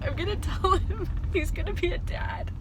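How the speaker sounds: crying while talking, voice high; choked-up happy tears; snotty crying. Real, not acted. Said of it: "ugly crying".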